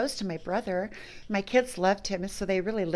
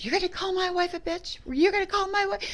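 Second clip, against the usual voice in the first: much higher, disbelieving outrage; outraged brother; 10 semitones higher than usual. Retold or imitated. Brother defending his wife against his sister, high outraged challenge. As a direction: throw the accusation back in disbelief. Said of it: "And he goes".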